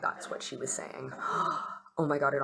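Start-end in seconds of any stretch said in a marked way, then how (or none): 0.0-1.1 whispered dawning realization; breathy realization. Retold or imitated. Realizing what the soothsayer meant, whispered. 1.2-1.9 sharp unvoiced gasp of realization. Reacting to the soothsayer's message, a gasp.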